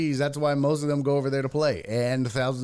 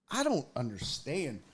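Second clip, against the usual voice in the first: usual speaking voice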